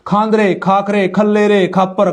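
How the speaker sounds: usual speaking voice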